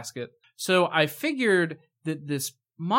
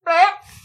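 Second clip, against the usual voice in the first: burst of laughter; 11 dB louder than usual. Real, not acted. Goat belch memory cracks her up.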